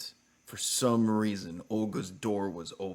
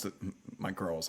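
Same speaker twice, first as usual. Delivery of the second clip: usual speaking voice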